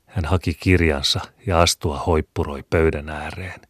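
plain reading voice

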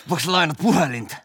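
a wasted stranger asks for a phone; drunk stranger voice. Retold or imitated. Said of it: "Sit se tulee sieltä".

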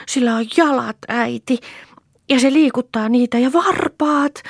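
put-on very high little-girl exclamation; high, loud, thrilled child voice; child's excited shout. A narrator's reading. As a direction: cry out each discovery to mother. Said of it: "huusi Lea".

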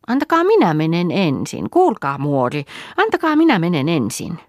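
put-on high, insistent little girl's voice; pleading child. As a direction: beg eagerly to be first. Sanna begs to go first. (anovasti, korkealla lapsen äänellä)

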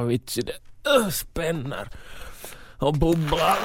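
deep sickly stammering phone message; sick groggy caller. Acted. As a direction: mumble like your stomach is churning.

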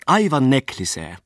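a lady's high, put-on foreign lilt on broken Finnish; foreign-accented Finnish. Merchant's wife gushing to the guests in her foreign accent. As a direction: chatter on in a light Swedish-tinged accent. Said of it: "epäsuomalaisella äänensoinnulla".

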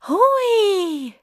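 put-on long, high whoop of a thrilled child; delighted squeal. A narrator's reading. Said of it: "Pippa hihkui".

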